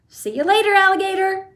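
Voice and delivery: high loud taunting shout; cheeky shout. A narrator's reading. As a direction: call it out gleefully. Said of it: "he shouted".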